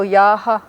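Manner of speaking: first a of yaha held long; long vowel. Shown as a demonstration. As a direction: hold the first vowel in yaha, short second. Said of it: "writing two vowels the same in a row".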